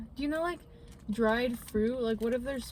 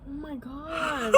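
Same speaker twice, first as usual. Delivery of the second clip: disgusted; 4 semitones higher, much slower than usual. Real, not acted. Reacting to spiders on people.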